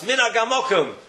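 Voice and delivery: high mock shout with a laugh; retold shout. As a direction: bang the table and cry it out. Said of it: "he shouts".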